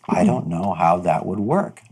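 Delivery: ordinary delivery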